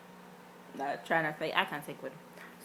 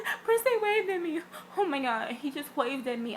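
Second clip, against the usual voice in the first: excited squeal; 8 semitones higher than usual. Retold or imitated. Fans squealing that Princeton waved, excited. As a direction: gush like a starstruck fan. Crowd squealing about the wave.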